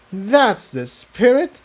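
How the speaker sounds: unsure encouragement. Acted. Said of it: "she didn't look entirely sure of herself".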